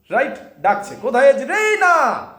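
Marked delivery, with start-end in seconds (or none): 1.5-2.3 high loud call of Reyna. Shown as a demonstration. Mother calling for her daughter. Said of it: "এরভাবে ডাকছে".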